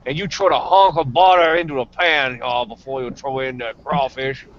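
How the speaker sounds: lightly put-on Cajun accent, describing cooking; a touch of Louisiana Cajun in the voice. Hammed-up Cajun accent telling a fellow Cajun how to cook crawfish. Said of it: "that's not my Louisianan accent. My Louisianan accent is".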